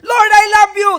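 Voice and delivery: loud, high mock cry to heaven; demonstrated shout. Preacher showing needless shouting to God, loud high mock call. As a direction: call it out as if heaven were far off. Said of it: "Hindi na natin kailangan isigaw".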